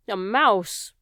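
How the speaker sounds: loud outraged bellow. Acted. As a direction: roar it out in disgust. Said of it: "bellowed the king".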